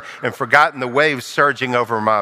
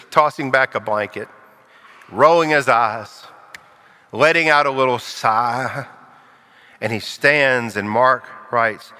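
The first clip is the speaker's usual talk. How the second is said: normal talking voice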